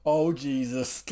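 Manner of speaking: drawn-out breathy groan of pleasure; mock moan of pleasure. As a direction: let it slip out slow like a moan. Reliving climax in the car.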